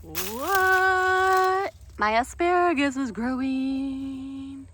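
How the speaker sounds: high, drawn-out happy disbelief; delighted surprise. Real, not acted. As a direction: sound amazed and thrilled, slowly. Delighted at surviving asparagus.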